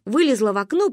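ordinary delivery

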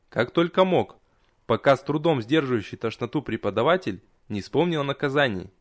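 usual speaking voice

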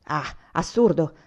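put-on old grandfather waving the plan away, forceful. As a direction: brush the idea off hard and slow. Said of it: "disse con forza il nonno".

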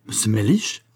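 incredulous echo with rising end; taken-aback question, voice lifting at the end; surprised exclamation. A narrator's reading. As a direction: query the name in disbelief. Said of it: "s'écria le cocher".

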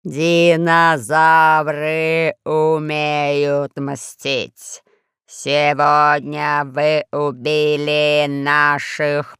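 низкий хриплый, очень медленно; медленно, по слогам, хрипло; low hoarse voice. A narrator's reading. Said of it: "заговорил медленно по слогам". (низким хриплым голосом, по слогам)

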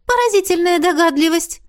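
high-pitched sarcastic ghost voice. A narrator's reading. Ghost told she is dead, high and sarcastic. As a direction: retort drily in a high voice.

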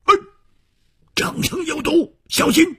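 high-pitched cry of warning; urgent warning. Acted. Ally warns Chu of poison, high urgent warning. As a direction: call out sharply high, alarmed.

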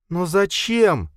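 high, slow, bitter question; bitter plea. A narrator's reading. Linda bitterly asking Khalid why, bitter. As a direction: ask it bitterly, hurt and drawn out. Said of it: "горько спросила она".

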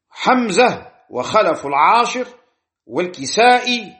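normal talking voice

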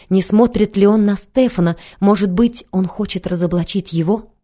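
ordinary delivery, nothing marked

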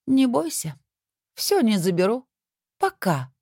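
high teasing sing-song; promising smile. A narrator's reading. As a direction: smile through it, full of promise. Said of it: "многообещающе улыбнулась Анжелика".

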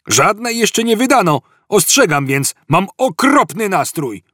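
high-pitched shouted grievance; calling out a warning. A narrator's reading. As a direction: warn them across the hall about your mood. Second cop warning about foul mood. (wykrzyczane ostrzeżenie, wysokim głosem)

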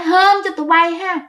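high, sneering threat in a ghostly voice; possessed mother's menacing taunt. Retold or imitated. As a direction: taunt the son like a spiteful spirit. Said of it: "bà nói".

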